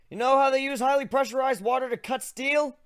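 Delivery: put-on a young griffin showing off his magic; griffin hero voice. Narrator voices his own griffin character.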